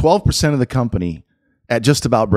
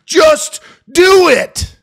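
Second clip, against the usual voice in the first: slow, loud, high shouted just do it; Shia LaBeouf impression; 8 dB louder, much slower than usual. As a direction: yell it like the meme. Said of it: "the Shia LaBeouf".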